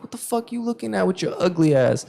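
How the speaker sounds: high, taunting challenge; retold trash talk. Player taunting the man staring at him, retold trash talk. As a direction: pitch it up and provoke him. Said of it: "I literally ran my mouth".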